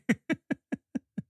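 real helpless giggling; high, breaking-up giggle; uncontrollable laughter. Real, not acted. Host cracking up at this guy, uncontrollable laughter. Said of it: "I can't stop laughing".

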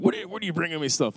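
high, fast mock protest; mock complaint. Mock protest at mother's gifts.

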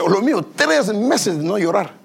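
ordinary delivery

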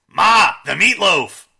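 loud, high mock bellow at his mother; hammed-up yell to his mother about the meatloaf; Will Ferrell bellow. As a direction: bellow it like a grown man yelling for his mom. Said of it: "But mine is".

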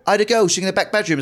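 a lightly voiced builder explaining drilling work outside. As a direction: chat to the homeowner like a friendly builder. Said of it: "He went".

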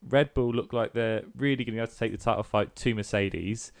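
ordinary talk

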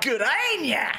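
put-on high, drawn bogan Australian accent; hammed-up bogan voice. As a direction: exaggerate a bogan Aussie greeting. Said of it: "just in a more bogan accent".

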